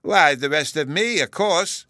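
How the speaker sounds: put-on high, loud little-boy triumph; crowing child's comeback; triumphant. A narrator's reading. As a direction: exclaim it as if you've won. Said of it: "Bruno exclaimed triumphantly".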